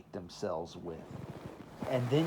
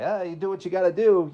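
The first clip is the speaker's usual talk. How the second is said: higher, louder and fast, shrugging it off; dismissive parent; 8 semitones higher, 10 dB louder, faster than usual. Retold or imitated. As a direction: toss it off like a parent with no sympathy. Parents voiced, brushing it off.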